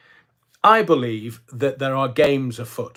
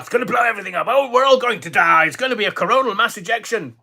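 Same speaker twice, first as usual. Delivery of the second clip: mock panic; 7 semitones higher, faster than usual. Mocking panicked politicians.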